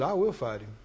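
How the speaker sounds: low, soft, understated vow; quiet first resolve. Retold or imitated. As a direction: say it low, as faith just starting to stir. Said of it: "It just gets stronger and stronger".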